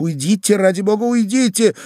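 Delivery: high, urgent plea; frantic pleading. Acted. Lady begs admirer to leave before husband comes, frantic pleading. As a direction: beg him desperately to go.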